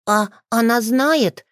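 put-on a boy starting to fret; mild worry in a child's voice; a little worried. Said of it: "Тео слегка заволновался".

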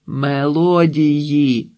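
«Мелодії» drawn out syllable by syllable; long held vowels on «Мелодії»; slow correction. A narrator's reading. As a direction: repeat «Мелодії» very slowly for those who misheard. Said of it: "відповіла їм Марта".

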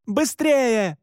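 high, urgent call; shouted urging. A narrator's reading. Olivet hurries the hunters in pursuit, high urgent shout. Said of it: "крикнула Оливет".

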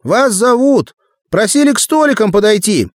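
put-on high, loud boy's call; shouting. A narrator's reading. Boy passing a summons to men on a fence, shouting. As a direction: call the message up to them at full voice. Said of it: "крикнул он".

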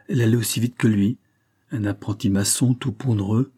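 usual speaking voice

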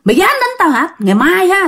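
high-pitched, outraged spirit cry; mock outcry. Spirit cries out when the uncle strikes it, high indignant outcry. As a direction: cry out in protest without full volume. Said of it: "bắt đầu nó la làng lên".

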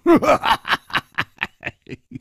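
a jolly hero's laugh; merry laugh. Acted. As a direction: laugh at his cowardice. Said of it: "笑着说".

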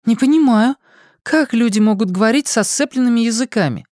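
put-on high, young girl's voice; a lightly voiced young girl.

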